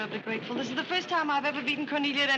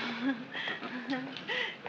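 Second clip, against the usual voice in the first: soft laugh; 5 dB quieter than usual. Acted. Woman amused by his other coat joke, laughing softly.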